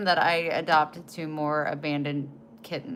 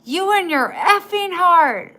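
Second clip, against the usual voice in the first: higher, louder mock angry yell; dad's yell; 12 semitones higher, 12 dB louder than usual. Imitating her dad yelling at her over chickens, exasperated. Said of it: "he goes".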